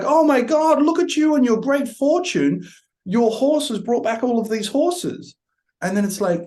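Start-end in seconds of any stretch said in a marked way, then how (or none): 0.0-5.4 excited neighbour. Retold or imitated. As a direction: imitate a neighbour gushing over good fortune. Neighbour congratulates farmer.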